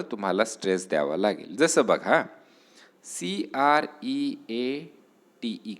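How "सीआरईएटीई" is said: slow letter-by-letter spelling. Shown as a demonstration. Teacher spelling a word for students, slow letters. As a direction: spell each letter slowly for the class. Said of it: "spells the word letter by letter".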